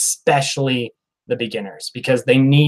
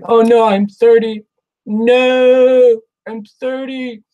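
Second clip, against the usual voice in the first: high, loud mock whine; hammed-up sulking over turning thirty; pouty whine; 9 semitones higher, 7 dB louder, slower than usual. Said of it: "I basically pouted like a little bitch".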